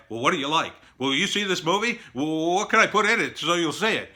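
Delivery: eager, high-pitched mock pleading; mimicking needy filmmaker. Filmmaker asking a focus group what they like, mock eager.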